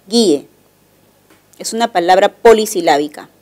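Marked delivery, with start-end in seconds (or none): 0.1-0.5 "guíe" as two syllables, guí-e, stress on the í; syllable-by-syllable demonstration. Shown as a demonstration. Teacher shows students the syllable break, "guíe" said as guí-e. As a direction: split "guíe" into guí-e, falling on the e. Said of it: "hacemos un silabeo de esta manera".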